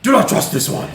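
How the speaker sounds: high, very loud echoed warning; hammed-up loud shout; imitated yell. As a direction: yell the warning as the possessed man. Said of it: "I'm gonna yell".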